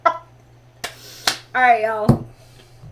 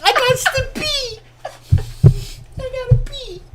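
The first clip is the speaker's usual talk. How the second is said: usual speaking voice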